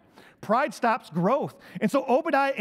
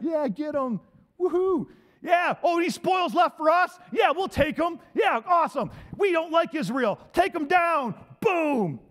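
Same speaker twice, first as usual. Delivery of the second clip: mock cheering crowd; 5 semitones higher than usual. Edomites cheering Babylon's attack, rowdy gloating shouts. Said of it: "they're cheering on Babylon".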